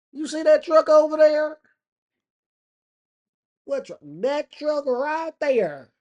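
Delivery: very high, loud, urgent pointing-out; hammed-up excited yokel; gawking country local. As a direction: holler it high in mock astonishment. Gawker showing Ma the truck.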